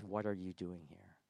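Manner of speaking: low, soft question; gentle. Acted. God in all his power comes to Elijah, gentle. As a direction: ask it tenderly and quietly. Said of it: "comes so gently".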